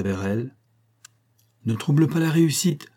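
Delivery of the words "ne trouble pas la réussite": high, sharp exclamation; woman crying out over her card game. A narrator's reading. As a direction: snap at him not to disturb the cards. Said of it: "s'écria-t-elle".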